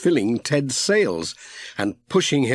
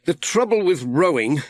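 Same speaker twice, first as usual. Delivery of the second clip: a hint of a grumbling postman, a little higher; Pat, lightly voiced; 6 semitones higher than usual. A narrator's reading. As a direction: Pat complaining mildly as he rows. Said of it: "said Pat".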